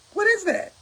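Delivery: high, incredulous "What is that?". Real, not acted. About members flattering union reps, high incredulous question. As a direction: rise up in disbelief on "What is that?".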